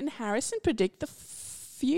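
loud, high, drawn-out announcer welcome; big theatrical host opening; grand show intro. Acted. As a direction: belt out the podcast title with a stretched last word. Host launches the show's title intro.

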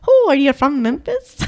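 exaggerated stage-Irish question; overdone Irish accent. Shown as a demonstration. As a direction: lay the Irish accent on too thick. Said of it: "That's a little too much".

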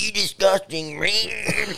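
high, slow mock junkie screech; imitating the junkie's drawn-out line; twitchy slurred sniffing addict. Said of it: "best junkie voice I've ever heard".

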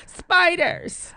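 high, loud mocking squeal; mock his answer playfully; teasing.

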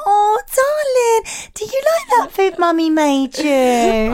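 put-on high, slow, drawn-out baby talk; mother cooing to her toddler about food. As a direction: stretch the darling like a doting mum. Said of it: "how you spoke to your children".

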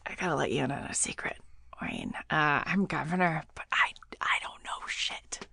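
hushed secretive whisper. Acted. Governor confiding a secret.

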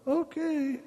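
high, soft mock-nervous okay; uneasy okay. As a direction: squeak it out quietly as if rattled. His uneasy reply while teaching.

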